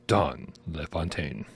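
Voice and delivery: low, slow movie-announcer voice; trailer-voice homage. Acted. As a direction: intone it like a trailer narrator, stretched out. Echoes trailer voice actor's name.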